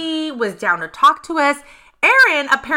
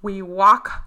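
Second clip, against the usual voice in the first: ordinary talk, nothing marked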